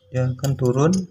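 ordinary talk, nothing marked

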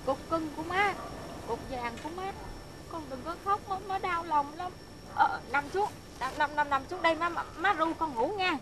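put-on calm a crying child with tender coaxing; soothing old mother. Old mother hushing her grown son to lie down, tender.

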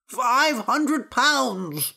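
high, very slow plaintive offer; anxious priest. A narrator's reading. Priest in the basket names his ransom, plaintive. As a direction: wail the sum out slowly.

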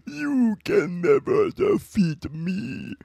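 villain taunt. Acted.

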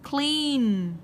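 CLEAN with a long, held ee. Shown as a demonstration. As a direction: stretch the vowel in "Clean". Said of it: "Đều là y dài hết".

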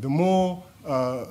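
ordinary talk

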